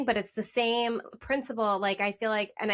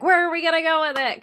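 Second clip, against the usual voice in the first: higher, louder, eager burst; excited; 8 semitones higher, 7 dB louder, faster than usual. Retold or imitated. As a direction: blurt it out with excitement. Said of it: "I'm, like, really excited".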